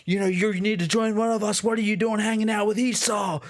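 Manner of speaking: high, mock street-preacher holler; building into a high-pitched mock shout; imitated yelling. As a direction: harangue a passerby, hammed up. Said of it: "they're gonna start yelling at him".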